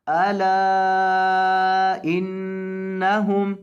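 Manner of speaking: अला stretched long, नून of इन्नहुम held nasal; slow recitation with a long ला and a nasal न; lengthened and nasal. Shown as a demonstration. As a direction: hold the ला long and hum the doubled न. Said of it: "लाम के ऊपर मद करेंगे और नून के ऊपर गुना करेंगे".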